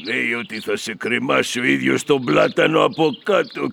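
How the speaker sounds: normal talking voice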